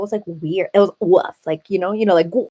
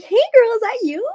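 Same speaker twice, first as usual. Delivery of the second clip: high, bright mock greeting to an old coworker; bubbly greeting; 14 semitones higher, 6 dB louder than usual. Greeting a former coworker, in a high bubbly voice. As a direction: squeal out a friendly hello.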